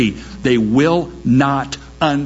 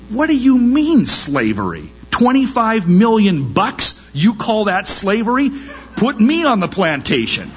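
much higher, scoffing disbelief; incredulous scoff; 8 semitones higher than usual. Retold or imitated. Preacher voicing listeners mocking the slavery claim, incredulous scoff. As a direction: laugh off the claim as absurd. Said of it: "And we would say".

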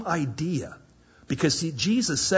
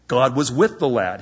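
normal talking voice

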